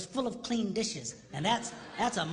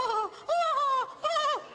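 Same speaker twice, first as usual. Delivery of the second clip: high gasping oh oh oh; mimicking a thrilled wife, high squeals; delighted gasp; 5 dB louder, much slower than usual. Woman lighting up at kindness.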